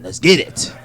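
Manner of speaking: ordinary talk, nothing marked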